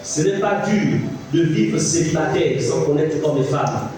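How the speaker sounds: ordinary delivery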